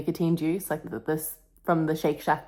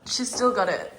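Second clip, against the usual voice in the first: proud; 3 semitones higher than usual. Real, not acted. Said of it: "You know what?".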